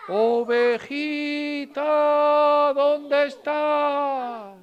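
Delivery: slow, high, drawn-out calling; wolf searching the snowy hills for the lost sheep, slow high calling. Acted. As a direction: call across the hills, long and high.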